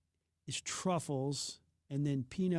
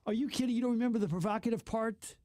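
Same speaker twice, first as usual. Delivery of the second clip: higher-pitched, teasing disbelief; can't believe they forgot that; incredulous; 6 semitones higher than usual. Real, not acted. Radio host to co-host who forgot a TV show, incredulous.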